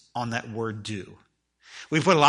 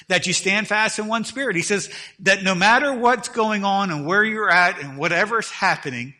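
raised, driving pulpit voice, higher and louder; fiery preaching; 5 semitones higher, 4 dB louder than usual. Acted. Pastor exhorting his church to be faithful, preaching hard. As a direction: push it out to the whole church.

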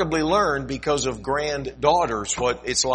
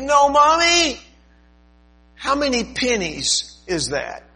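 put-on much higher, louder child voice asking; curious little girl; 14 semitones higher, 6 dB louder, slower than usual. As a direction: ask innocently like a small girl. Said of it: "she said".

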